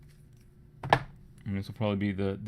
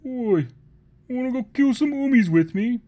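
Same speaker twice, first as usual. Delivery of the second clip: much higher, much louder goblin-like orc call; cheeky orc; 12 dB louder than usual. Pretend. Orc inviting his mate to kill Hoomies: high loud orc call. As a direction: shout it like a scrappy greenskin. Orcs inviting each other to raid.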